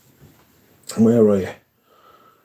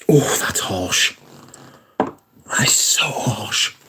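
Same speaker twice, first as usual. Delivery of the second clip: hushed dismay; much slower than usual. Real, not acted. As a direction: let it fade to a breath. Reacting to scent, voice drops.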